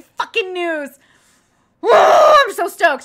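shrieking, drawn-out celebration; real burst of uncontained excitement; ecstatic scream. Real, not acted. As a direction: whoop it out very loud and high. Celebrating great news from doctor.

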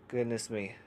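ordinary delivery

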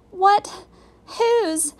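high, startled, broken questions; shocked sputter. A narrator's reading. Girl reacts to the staring eyes, shocked sputter. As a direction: sputter each word separately, pitched high. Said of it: "sputtered Annie".

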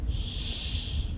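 soft, drawn-out shush; long hushing shh. Real, not acted. Player hiding as Santa comes up, soft shushing. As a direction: hush very quietly.

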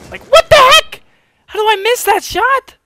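high, loud yell of disbelief; outraged yelling. Real, not acted. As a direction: yell it, appalled at your own miss. Outraged at missing a shot.

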